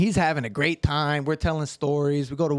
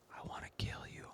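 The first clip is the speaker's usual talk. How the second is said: very soft, voiceless retold threat; whispered threat; 21 dB quieter than usual. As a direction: imitate a menacing whisper.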